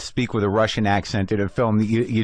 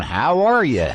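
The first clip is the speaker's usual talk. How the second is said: imitated Southern accent; 3 semitones higher, slower than usual. Host mimics accent tapes for Southern dialect, imitated Southern accent. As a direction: put on a drawled dialect-tape Southern accent. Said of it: "get tapes of people going".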